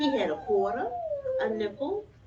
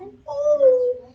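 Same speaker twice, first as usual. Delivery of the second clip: a high, loud "ooh" of wonder; surprised, thrilled "ooh"; delighted gasp; 13 dB louder than usual. Real, not acted. Gasp at the picture.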